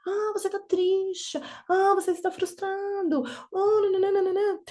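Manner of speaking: aguda e lenta, tom de consolo ensaiado; voz de nomear sentimentos. Retold or imitated. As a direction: imita a mãe que nomeia tudo, bem devagar. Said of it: "ensinam a gente a falar pras crianças". (mãe falando com a criança, voz aguda e lenta)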